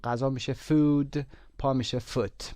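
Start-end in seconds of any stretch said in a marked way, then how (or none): 0.5-1.2 "food" with a long, drawn-out oo; teacher's clear long-vowel "food". Shown as a demonstration. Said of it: "اوی کشیده". 2.0-2.4 "foot" with a short oo; teacher's short-vowel "foot". Shown as a demonstration. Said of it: "اوی کشیده نخونید".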